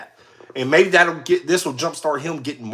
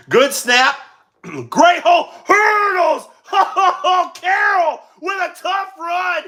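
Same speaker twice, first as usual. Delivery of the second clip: higher, energetic broadcast call of the game; live play-by-play; 9 semitones higher than usual. Acted.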